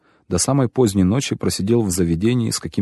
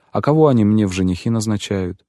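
ordinary delivery

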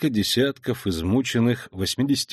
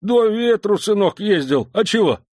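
put-on cheeky old man voice; 11 semitones higher, 4 dB louder than usual. Old veteran answers officer.

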